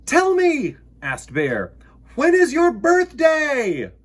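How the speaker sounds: high, loud eager bear voice; eager little bear asking; Bear's question. Acted. Bear asks the moon.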